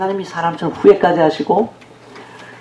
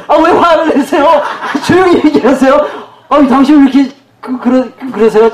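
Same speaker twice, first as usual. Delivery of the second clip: high, hammed-up scolding with laughter; mock indignant reproach; 8 semitones higher, 3 dB louder than usual. Provoker chiding the person they angered, mock indignant. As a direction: act affronted, pitched well up and half laughing.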